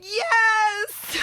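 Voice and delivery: a real high, loud shout of yes; excited yes. Real, not acted. Host shouting yes when the guest guesses Grandma appears, excited. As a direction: burst out yes, thrilled the guess is right.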